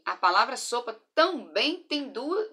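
usual speaking voice

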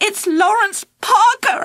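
high, panicked shout. Acted. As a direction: yell it out in a panic. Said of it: "I shouted".

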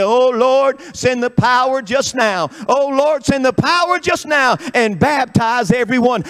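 mock congregation crying out to God, loud and high; acted revival prayer, pitched high; imitated fervent prayer. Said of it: "we lift up our hands and we say".

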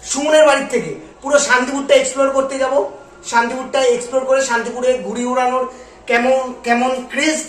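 ordinary delivery

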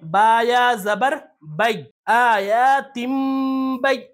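slow chanted letters, then the joined syllables; spelling-out recitation. Shown as a demonstration. Teacher building the word for learners, slow hijje chanting. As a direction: spell it out and draw out each joined sound. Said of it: "ہجے بھی کر لیتے ہیں".

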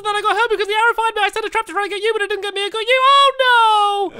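high, fast gabbling; speaking really fast. Shown as a demonstration. Players speed-talking for slowed video, high fast gabble. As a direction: rattle it off as fast as you can. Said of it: "Speak really fast".

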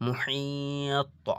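মুহিত drawn out slowly, the হ breathed from the throat; slow Quranic recitation. Shown as a demonstration. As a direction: recite মুহিত long, final ত landing firm. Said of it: "ঠিক সেভাবে দেখুন".

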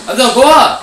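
shouted command; yelling. Real, not acted. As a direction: yell it at your teammate. Yelling at player.

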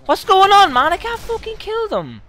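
very high, drawn-out wail of frustration; loud, slow, high-pitched protest; exasperated squeal. Real, not acted. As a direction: shriek in disbelief at failing. Unable to kill enemies.

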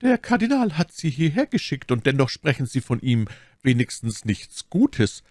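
high-pitched, courteous abbess voice; puzzled abbess. A narrator's reading.

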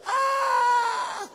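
loud, high mock wail; hammed-up crying scream. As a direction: imitate grown men bawling in fright. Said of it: "Pero yung labindalawang lalaki".

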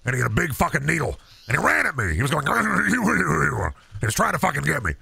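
high, worked-up radio ranter; ranting conspiracy host. Acted. Host warning his listeners about gnomes, worked-up rant.

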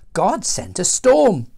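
ordinary delivery, nothing marked

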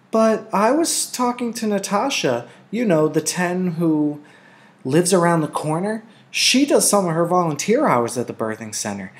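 put-on high, eager child's chatter; eager young girl. A narrator's reading. Lily eagerly argues with her mother, childlike. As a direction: rattle on, trying to convince mother.

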